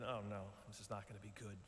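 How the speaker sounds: soft, quick muttered dread; worried inner thought. Retold or imitated. Viewer watching lions circle the baby, soft quick dread. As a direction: mutter it to yourself as it sinks in.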